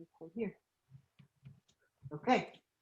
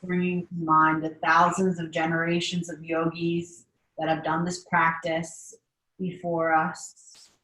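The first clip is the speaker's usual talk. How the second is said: guided meditation. Acted. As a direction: guide the class through breathing, unhurried. Leads a seated meditation.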